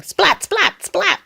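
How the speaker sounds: high, sharp splats; lightning splat. A narrator's reading. Reader makes Mister Brown's lightning, high splats. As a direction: strike each word high like lightning. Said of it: "He makes lightning".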